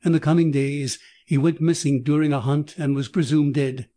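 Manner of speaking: ordinary delivery, nothing marked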